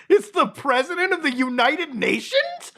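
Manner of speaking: high-pitched, incredulous question; amazed disbelief. Real, not acted. Amazed at what page shows.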